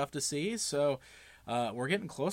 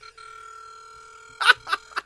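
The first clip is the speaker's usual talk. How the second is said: breathless laugh. Real, not acted. Laughing while the call rings.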